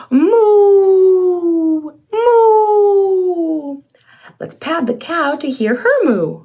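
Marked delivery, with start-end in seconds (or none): 0.1-3.8 a touch higher, louder mooing; playful cow moo. Shown as a demonstration. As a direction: moo for the little one. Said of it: "Let's moo together".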